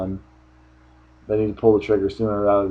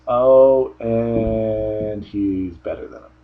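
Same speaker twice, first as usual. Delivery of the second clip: unimpressed shrug; 3 dB louder, much slower than usual. Retold or imitated.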